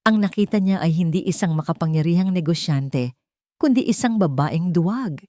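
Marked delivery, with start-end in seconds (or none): none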